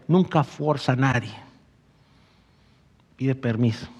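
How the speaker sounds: usual speaking voice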